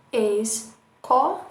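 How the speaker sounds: slow, syllable by syllable; syllables drawn out. Shown as a demonstration. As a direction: split it into beats slowly. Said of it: "quantos impulsos de voz". (escandindo devagar)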